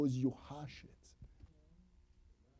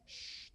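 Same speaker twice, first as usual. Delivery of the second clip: shushing; 16 dB quieter than usual. Shown as a demonstration. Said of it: "You are hushing".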